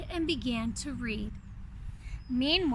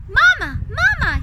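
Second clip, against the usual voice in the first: loud, high call for his mother; child calling Mama; 9 semitones higher, 12 dB louder than usual. Acted. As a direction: shout across the kitchen for Mama. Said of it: "he called".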